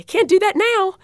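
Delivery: high-pitched gleeful retort; retold triumphant exclamation; triumphant reassurance. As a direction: voice leaps up, delighted for him. Retelling triumphant reassurance to patient.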